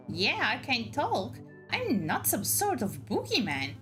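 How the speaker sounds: pixie. Acted. Moth creature answers, voiced higher.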